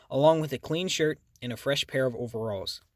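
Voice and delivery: plain reading voice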